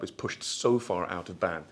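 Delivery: normal talking voice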